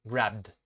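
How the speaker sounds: grabbed ending in a voiced D sound; final D sound. Shown as a demonstration. Teacher modelling grabbed for students, voiced D ending. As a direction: say grabbed with a clear D at the end. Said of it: "se va a pronunciar con D".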